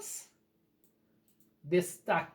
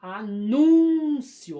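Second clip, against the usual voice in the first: anúncio with NÚN very long, loud and higher; a-NÚUUN-cio, swell on the middle syllable; stress on NÚN; 4 semitones higher, 10 dB louder, much slower than usual. Shown as a demonstration. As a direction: exaggerate the accented "nún" in "Anúncio". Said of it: "Então, acento".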